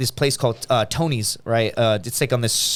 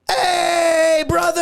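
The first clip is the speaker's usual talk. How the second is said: high, long-drawn group hail; mock hailing shout; much slower than usual. Gym crew greets the guy hiding his hookup, high drawn-out shout. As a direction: holler it out like the whole gang greeting him. Everybody hails him in retold scene.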